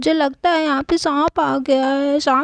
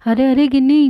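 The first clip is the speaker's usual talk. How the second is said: ordinary talk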